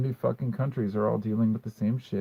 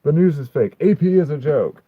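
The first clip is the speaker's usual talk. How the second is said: louder, higher mock ranting believer; mock conspiracy theorist; 5 semitones higher, 7 dB louder than usual. Streamer parodying commenters who call the news fake, hammed-up rant. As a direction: ham up their paranoid rant. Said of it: "you're just like".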